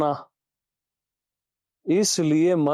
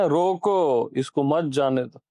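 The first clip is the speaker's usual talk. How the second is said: रोको set apart, then इसको मत जाने दो as one phrase; pause after रोको; 4 semitones lower than usual. Shown as a demonstration. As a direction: stand रोको alone as a command, मत joined to जाने दो. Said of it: "मन को क्या बोलते हैं?".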